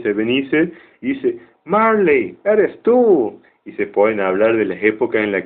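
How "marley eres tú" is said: agudo y asustado, con otro timbre; sorpresa asustada. Retold or imitated. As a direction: reconoce al fantasma con un respingo. Scrooge asustado reconoce fantasma. (Scrooge ve a Marley y se asusta, agudo)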